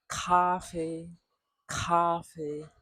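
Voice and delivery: Cafe with a clear puff of air on the k; 送气的k; aspirated k. Shown as a demonstration. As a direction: breathe out hard on the k of Cafe. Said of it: "a clear puff of air at the back".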